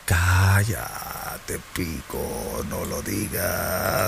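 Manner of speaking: a gruff inner voice shushing him; comic low hush to himself; hushing alter ego. Acted. Fake voice hushing the host.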